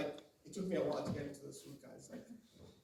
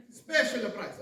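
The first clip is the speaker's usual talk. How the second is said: raised, loud sales call; souk vendor's cry; 5 semitones higher, 7 dB louder, much slower than usual. Acted. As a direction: hawk the bargain to passers-by. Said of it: "what's the first thing you hear".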